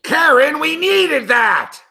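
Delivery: anguished groan. Real, not acted. Groaning at missed basket.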